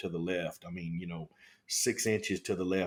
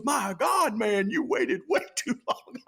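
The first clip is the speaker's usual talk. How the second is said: much higher, louder mock scolding; exasperated shout; 13 semitones higher, 3 dB louder than usual. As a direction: scold him loudly. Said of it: "He goes".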